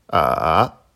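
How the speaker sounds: A dipping low then climbing back up; third tone on A. Shown as a demonstration. Teacher showing learners Mandarin A, dipping tone. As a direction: draw A down and up again. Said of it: "Je les répète".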